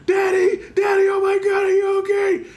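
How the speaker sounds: put-on high, frantic child's cry of Daddy; imitating his little girl. Little daughter crying out to her fallen dad, high panicked child voice. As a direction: squeal it like a scared little kid. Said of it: "my baby yelling".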